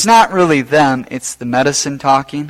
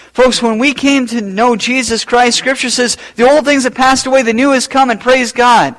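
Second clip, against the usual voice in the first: fervent preaching; 7 semitones higher than usual. Acted. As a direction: proclaim it to the congregation with fire. Preacher rising to full force.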